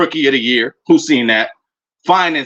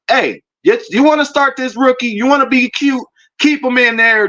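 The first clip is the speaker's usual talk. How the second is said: heated taunt; 11 semitones higher than usual. Acted. Taunting the rival coach.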